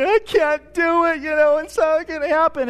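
high, mock-tearful little girl voice; imitating crying child. Father imitating his daughter crying at bedtime. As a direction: whine it like a panicked nine-year-old. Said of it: "and she's crying".

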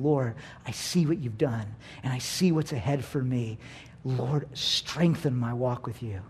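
soft, low prayer; reverent prayer. Acted. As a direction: pray it quietly and humbly. Models a reverent communion prayer.

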